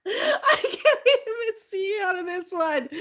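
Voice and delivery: talking through giggles, slow; laughing at the hat over her eyes. Real, not acted. Trying on a hat that covers her eyes, laughing.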